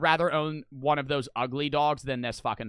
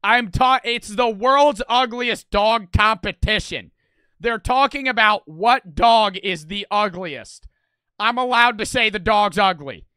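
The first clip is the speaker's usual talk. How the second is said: loud, emphatic self-defence; indignant; 3 semitones higher, 9 dB louder than usual. Real, not acted. Streamer defends calling a contest dog ugly, loud and indignant. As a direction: argue it loudly, hammering out each point.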